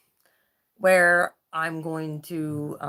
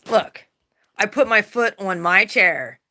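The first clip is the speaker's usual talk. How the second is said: ordinary delivery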